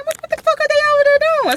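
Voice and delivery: very high, fast, baffled squeal; what on earth are they doing; squeaky outraged question; shrill disbelief. Retold or imitated. Suspicious of the two men.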